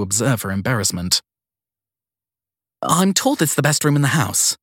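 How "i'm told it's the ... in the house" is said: put-on flustered young woman. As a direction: caught off guard and eager to please. Sadie reassures guest.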